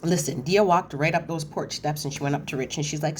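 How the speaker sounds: ordinary talk, nothing marked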